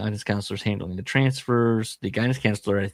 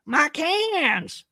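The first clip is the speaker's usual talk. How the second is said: squeaky high cartoon cry; much higher mock character yelp; Larry the Can Man. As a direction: shriek it out like a goofy can collector. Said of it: "I'll do Larry the Can Man all day".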